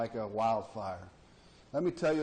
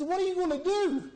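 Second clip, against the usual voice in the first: high voice; 4 dB louder than usual. Acted. Buddy asks in joke.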